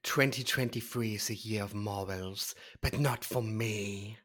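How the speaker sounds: a light put-on British accent, low and soft; lightly posh British drawl, slow at the end. Cohost mocking his own new year, a light British accent. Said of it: "try to make that as your British accent".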